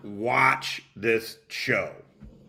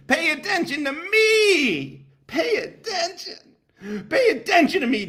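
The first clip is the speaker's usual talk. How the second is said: Finkel impersonation. Retold or imitated. Imitating Finkel begging chat for attention, high and whiny. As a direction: beg for attention like a needy kid. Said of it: "Finkel impersonation".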